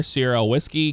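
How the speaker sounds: ordinary talk, nothing marked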